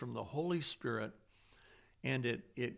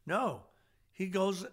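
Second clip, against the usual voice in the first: ordinary delivery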